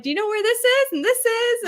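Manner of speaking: high, loud, quick-fire questions to strangers; eager asker. Acted. Concertgoer asking random people for directions, high eager asking. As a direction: pipe up brightly as if stopping passersby.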